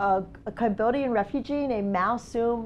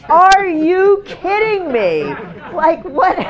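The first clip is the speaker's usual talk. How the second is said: high, loud, slow, stretched disbelief; incredulous outburst; 7 semitones higher, 9 dB louder, much slower than usual. Retold or imitated. Father sees daughter's prom outfit, loud incredulous anger. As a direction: drag out his outrage at the outfit. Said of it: "he's going".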